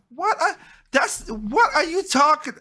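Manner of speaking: high, incredulous sputter; stunned disbelief. Retold or imitated. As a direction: sputter it out in shock. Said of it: "I'm like".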